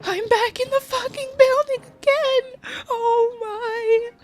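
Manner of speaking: very high, loud triumphant squeal; hammed-up movie hero. Mocking her own escape from outtakes, she squeals triumphantly. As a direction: shriek it like a freed hero.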